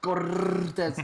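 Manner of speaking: Cortez with a heavy rolled R, anchor style; rolled newscaster R. Pretend. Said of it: "Like five little R's".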